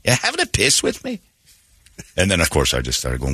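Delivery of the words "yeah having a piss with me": high, mock-Scottish line; Scottish accent. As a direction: jump up high into the Glasgow voice. Quoting the Scotsman again.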